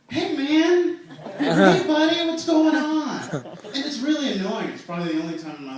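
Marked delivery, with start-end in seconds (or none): none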